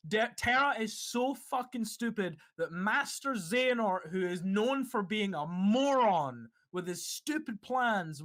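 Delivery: usual speaking voice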